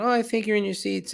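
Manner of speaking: high-pitched, hurried courtesy; imitated polite woman. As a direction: lightly imitate a woman pointing out a mistake. Voices woman claiming her seats.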